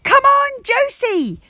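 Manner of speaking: high, loud cheering shout; shouted encouragement. Acted. Parents cheer Josie from the crowd, loud high shout. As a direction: yell her on from the crowd. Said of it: "shouted Mister and Missus Jump".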